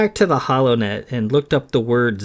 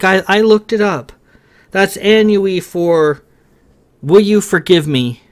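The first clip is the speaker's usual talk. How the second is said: higher and louder, eager and nervous; hopeful earnest plea; 8 semitones higher, 5 dB louder than usual. Acted. Kay tries Sam's language to ask forgiveness, nervous and hopeful. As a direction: offer the foreign words hopefully.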